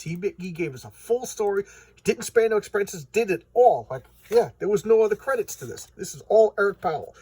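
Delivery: high-pitched, emphatic gushing rant; enthusiastic raving. Real, not acted. Reviewer praises a free comic to viewers, ranting and raving. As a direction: rave about it, getting carried away. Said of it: "now I'm ranting and raving".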